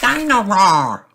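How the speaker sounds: put-on high, loud, slow toddler cry; delighted small child naming a toy; George. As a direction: squeal it out long and high. George voiced, calling for toy.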